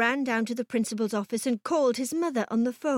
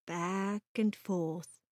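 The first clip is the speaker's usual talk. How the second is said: plain reading voice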